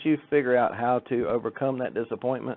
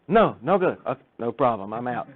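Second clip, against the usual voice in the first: mock gruff refusal; 7 dB louder than usual. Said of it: "And he would just".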